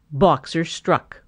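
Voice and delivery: plain reading voice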